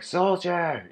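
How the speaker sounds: high-pitched general's summons; calling out. Acted. Third general to his soldier, calling out.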